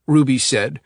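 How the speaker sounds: ordinary delivery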